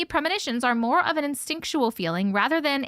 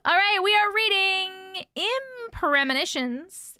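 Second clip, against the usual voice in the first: slow, high announcer intro; slow storyteller announcement; story intro; 8 semitones higher, slower than usual. Acted. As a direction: announce the title grandly.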